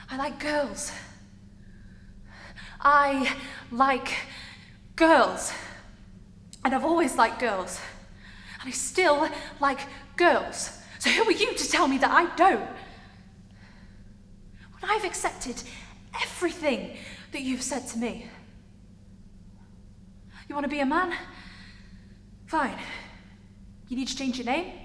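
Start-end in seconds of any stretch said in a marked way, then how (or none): 2.8-12.7 emphatic defiance. Acted. Character confronting a partner, defiant and building. Acted confrontation, insisting on identity. 20.5-21.1 pointed question with rising end; challenging question. Acted. Character confronting changing partner, pointed question.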